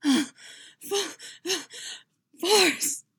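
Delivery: high, loud gasping exclamation; gasp, break off, then cry out; overwhelmed cry. A narrator's reading. Overwhelmed cry at peak of passion.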